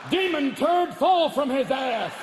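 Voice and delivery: loud, slow, booming priestly command; hammed-up exorcist. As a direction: cast out the demon. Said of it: "priest to do a rectal exorcism".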